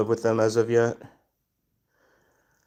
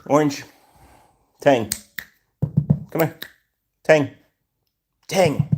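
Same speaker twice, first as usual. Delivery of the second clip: short, higher-pitched calls to a pet; calling a cat; 6 semitones higher than usual. Real, not acted. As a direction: call the cat over, one word at a time.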